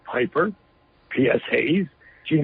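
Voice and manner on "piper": ordinary talk, nothing marked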